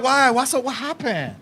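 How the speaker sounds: softly imitated Chinese girlfriend; mock Chinese accent. Girlfriend asking her man what happened, mock Chinese accent. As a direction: innocent questions in a put-on Chinese accent. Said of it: "She was like".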